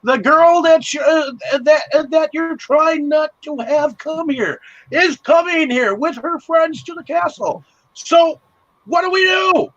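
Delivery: high squeaky panicked messenger voice, building to a yell; a frantic little herald rushing in with bad news; panicked goblin herald. Herald warning the Goblin King, high and panicky. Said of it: "tells him".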